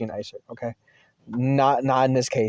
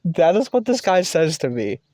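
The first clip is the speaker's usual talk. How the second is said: normal talking voice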